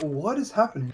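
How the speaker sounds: usual speaking voice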